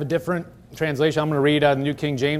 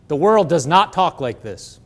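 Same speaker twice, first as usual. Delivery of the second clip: ordinary talk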